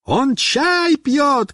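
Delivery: very drawn-out high call; slow high yell; shouting. A narrator's reading. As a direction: holler back, stretching each word. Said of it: "кричит Мишка".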